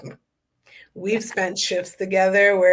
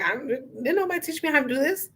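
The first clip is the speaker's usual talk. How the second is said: exasperated inner voice; 7 semitones higher, 3 dB quieter than usual. Retold or imitated. Voicing a confused inner cry.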